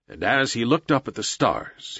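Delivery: plain reading voice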